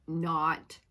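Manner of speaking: ordinary talk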